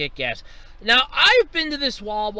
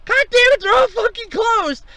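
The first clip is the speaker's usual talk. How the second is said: much higher, louder yelled curse; furious swearing; 8 dB louder than usual. Retold or imitated. Driver yelling that every pump is closed, high loud fury. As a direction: blow up at the closed station. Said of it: "I'm like".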